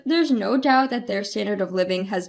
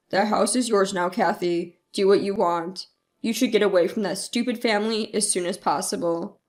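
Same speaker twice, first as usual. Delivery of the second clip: lower-pitched uncle; uncle's voice; 6 semitones lower than usual. A narrator's reading. Uncle gives Kathy the house, in a low voice. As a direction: drop the pitch for an older relative's advice.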